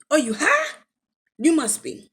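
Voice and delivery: high, loud, disbelieving retort; incredulous scolding. Retold or imitated. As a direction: challenge him as if he's lost it. Retelling her quarrel with him.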